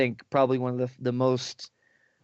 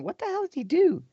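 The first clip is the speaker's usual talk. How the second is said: baffled; faster than usual. Retold or imitated. Retelling reaction to anime scene.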